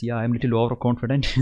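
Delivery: ordinary talk, nothing marked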